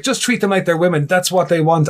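high-pitched clueless uncle impression; know-it-all uncle. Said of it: "in my head being like".

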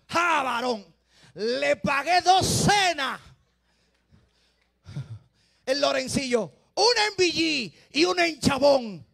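high, loud, slow mocking triumph over the dinners; triumphant shout. Preacher shouting at the congregation about the dinners. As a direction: laugh it off in a shout.

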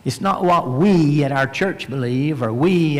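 ordinary delivery

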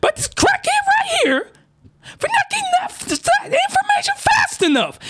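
squealing shouted outrage, voice way up high; high-pitched outraged rant. Real, not acted. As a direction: yelp it out in disbelief. Outraged rant about the plot.

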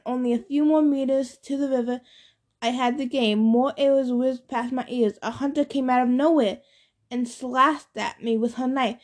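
ordinary delivery, nothing marked

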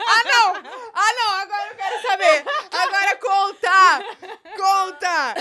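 loud, laughing pleading; excited. Real, not acted. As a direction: beg loudly for the story. Begging to hear the gossip.

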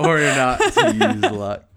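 loud, high words through laughter; laughing. Real, not acted. Player debating a luck point, laughing. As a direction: say it while cracking up.

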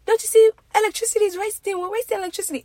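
mock a Nigerian father scolding about the lights; high, loud Nigerian accent nagging; Nigerian accent dad. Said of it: "in his accent, he'd be like".

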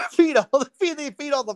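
laughing. Real, not acted. Laughing punchline of hunting story.

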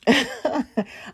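short high chuckle; laughing. Real, not acted. Speaker amused by her own story, laughing. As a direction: let out a quick, high laugh. Chuckles before joking aside.

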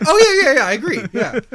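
high loud Mario voice. Retold or imitated. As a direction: agree eagerly as Mario. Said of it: "It's like".

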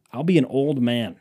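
ordinary delivery

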